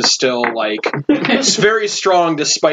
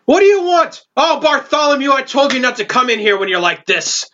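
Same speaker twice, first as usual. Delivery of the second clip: gruff dwarf barkeep; 10 semitones higher than usual. Acted. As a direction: scold him like an angry barmaid. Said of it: "look at you and go".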